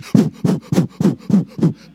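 huge, loud panting laugh while running; loud huffing run noise. Retold or imitated. Huffing and puffing through the airport. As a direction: puff it out like a heavy man sprinting.

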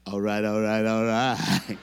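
low, loud satisfied drawl of approval; smooth pleased. Retold or imitated. Thrilled about the limo, low satisfied repeat. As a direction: savor the free limo. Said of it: "I said".

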